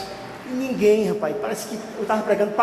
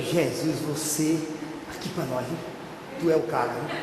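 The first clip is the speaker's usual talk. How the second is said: soft, low, private aside to Jesus; inward praise; 6 semitones lower, 8 dB quieter than usual. Retold or imitated. Pastor praises God inside on seeing her, hushed aside. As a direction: say it under your breath in awe. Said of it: "dando glória a Deus aqui dentro".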